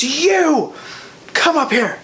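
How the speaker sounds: a lightly voiced show host calling kids up; light mock host; cast member. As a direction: call them up like a cheery host. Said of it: "and he goes".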